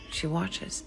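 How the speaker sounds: usual speaking voice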